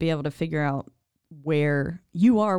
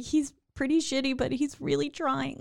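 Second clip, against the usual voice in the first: higher, whiny plea for him; pleading defense; 9 semitones higher, 3 dB quieter than usual. Retold or imitated. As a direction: beg on his behalf, half-joking. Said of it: "I was like".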